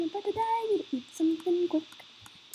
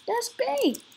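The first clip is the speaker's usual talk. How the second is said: usual speaking voice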